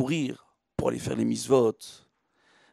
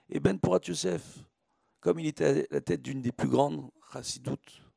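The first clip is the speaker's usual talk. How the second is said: usual speaking voice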